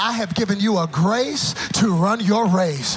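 loud, high, rhythmic sermon climax; full-force preaching. Real, not acted. Preacher telling church to run their race, fiery preaching. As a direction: build from firm declaration to soaring shout.

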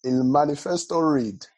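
put-on slow, loud Nigerian-accented Spanish; hammed-up Nigerian accent. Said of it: "why are you speaking in a Nigerian accent".

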